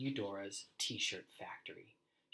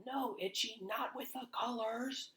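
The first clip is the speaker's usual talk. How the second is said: uncle's yell; 12 semitones higher than usual. A narrator's reading. Uncle yelling at dog before the bleach spills, high alarmed shout. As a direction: cry out to stop the dog.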